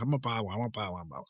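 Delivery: put-on a light teen son voice trailing off quietly; imitating son. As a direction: mumble it like a kid who won't let up. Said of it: "he's like".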